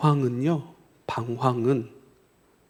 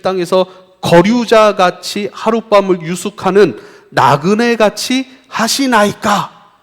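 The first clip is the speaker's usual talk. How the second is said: loud, raised prayer recitation; impassioned prayer; 4 semitones higher, 8 dB louder than usual. Acted. People confessing sins to the Lord, in impassioned prayer. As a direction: cry out the scripture as a plea. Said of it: "이렇게 기도합니다".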